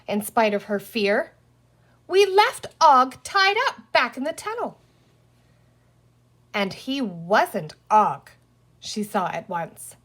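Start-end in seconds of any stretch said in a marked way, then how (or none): 2.1-4.8 much higher, louder cross insistence. Acted. Penny crossly telling the green man about Og, high. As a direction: press the point, indignant and scared. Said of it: "said Penny crossly".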